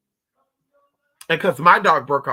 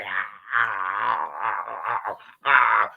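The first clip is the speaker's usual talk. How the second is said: imitated dog growl; 7 semitones lower, much slower than usual. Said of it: "She was like".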